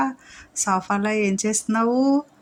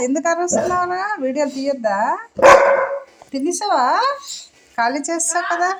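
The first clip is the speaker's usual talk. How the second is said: playful scolding; 9 semitones higher, 4 dB louder than usual. Real, not acted. Owner teasing barking dog, high playful scold. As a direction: tease the dog for yelling.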